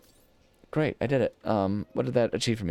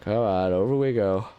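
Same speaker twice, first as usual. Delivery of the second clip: normal talking voice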